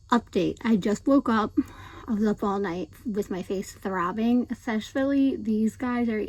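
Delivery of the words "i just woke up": ordinary delivery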